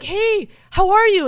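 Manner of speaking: high, loud, gushing hello; exuberant phone greeting. Acted. Daughter calling her dad, exuberant high greeting. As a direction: pitch it way up, overly cheerful. Acts out another call to dad.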